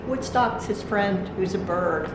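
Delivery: ordinary delivery, nothing marked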